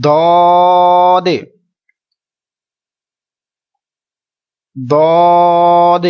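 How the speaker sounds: دواد held three counts, very slow and loud; heavy drawn-out دواد; three-beat stretch. Shown as a demonstration. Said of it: "repeat کرتے جائیے".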